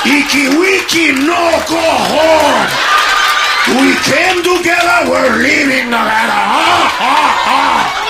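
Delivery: slow, loud, garbled alien growl; Jabba the Hutt voice. Retold or imitated. Ugly friend as Jabba the Hutt, blocking the hookup. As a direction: bellow like Jabba refusing.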